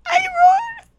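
high shouted boast. Acted. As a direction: yell it out, high-pitched. Drunk teacher shouts boast.

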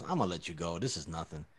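low, hushed imitation of a lenient cop; low-key cop reassurance. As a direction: play the cop quietly letting it slide. Cop letting him off in story.

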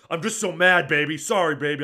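retold outburst, pushed up in volume; mock worked-up apology. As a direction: blurt it out loudly, mocking his excuse. Retold husband blurting mid-sex apology.

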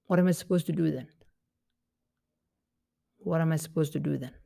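quick, exasperated rhetorical question; fast frustrated question. Shown as a demonstration. Person with no water asks you, quick and exasperated. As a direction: rattle it off, exasperated. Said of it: "they may say".

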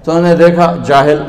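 ordinary talk

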